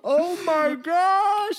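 very high, loud, stretched mock gasp; hammed-up astonishment; mock shock. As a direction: gasp like a soap opera audience.

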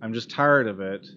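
ordinary delivery

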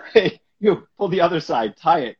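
loud, high bossy shout; shouted orders. Retold or imitated. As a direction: bark orders across the table. Jackie ordering crew, tarp.

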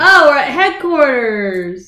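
drawn-out dawning realization; slow realization. Real, not acted. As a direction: let it sink in slowly.

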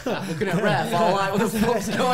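loud, high-pitched laughing disbelief; high mock-confused outburst through laughter; laughing bewilderment. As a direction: crack up while acting baffled. Laughing retelling of bewildered win.

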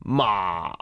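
まあ low and held down; third tone. Shown as a demonstration. Teacher demonstrating third tone, low まあ. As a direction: sink まあ low, keep it there. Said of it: "第三声".

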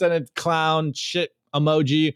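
normal talking voice

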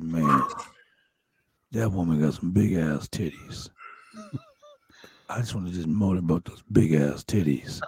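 low, lecherous wrestler impression; Jake the Snake Roberts. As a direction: drop the pitch and savor it. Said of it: "he's like".

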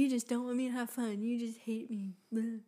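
lightly mimicked whiny teen; half-hearted teenage complaint, tossed off; mock sulky teenager. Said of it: "and you're like".

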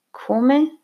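high, rising question of surprise; almost lucid. A narrator's reading. Drunk man startled by news of the visitor's arrival, almost lucid. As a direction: pitch jumps up, suddenly attentive. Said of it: "disse quasi lucido".

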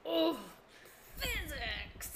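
mock exasperation. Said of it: "And I was like".

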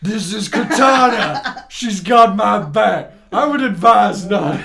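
loud, slow mock-heroic bellow; parody exposition hero. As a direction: belt out the introduction, on the edge of laughter. Mocking Mister Exposition introducing Katana.

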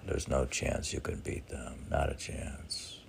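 normal talking voice

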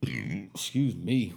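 a slow, soft burp through the words. Real, not acted. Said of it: "I'm about to burp".

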